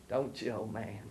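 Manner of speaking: usual speaking voice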